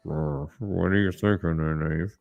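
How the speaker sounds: low, soft snide voices; a hint of a sneering rival; catty mock voices. Glaring rival needling Egwene, softly snide.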